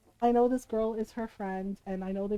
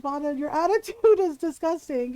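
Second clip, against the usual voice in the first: stern scolding; 5 semitones higher, 6 dB louder than usual. Retold or imitated. Scolding the friend.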